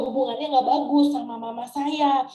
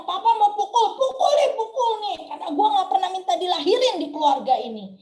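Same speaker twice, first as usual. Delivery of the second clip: frenzied defiant outburst; 3 semitones higher, 6 dB louder than usual. Retold or imitated. Daughter retells daring her papa to hit her, frenzied and defiant. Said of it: "saya seperti kesetanan".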